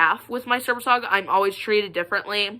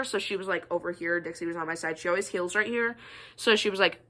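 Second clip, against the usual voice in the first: normal talking voice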